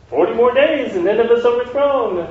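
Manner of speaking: high, lightly voiced doom proclamation; prophet proclaiming. Jonah proclaiming doom on Nineveh, lightly voiced. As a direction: announce it like a street prophet.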